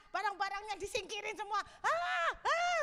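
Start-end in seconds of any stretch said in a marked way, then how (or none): 1.9-2.8 two high, loud "aah" yells; angry scream. Acted. David venting at himself, angry screaming. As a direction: yell out a mock tantrum. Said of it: "gitu, marah-marah dia".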